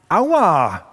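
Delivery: a short, mildly voiced ouch; a read-aloud yelp, only lightly acted; ox crying out. A narrator's reading. Ox kicked by the donkey, a mild ouch. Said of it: "Aber dann schrie er".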